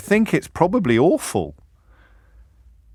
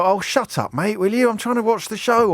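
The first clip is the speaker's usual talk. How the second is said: higher, exasperated telling-off of the man beside you; irritated shush; 8 semitones higher than usual. Retold or imitated. Annoyed onlooker telling the heckler beside him to shut up, high and exasperated. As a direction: snap at him to pipe down, in a raised pitch. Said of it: "I turn to you and go".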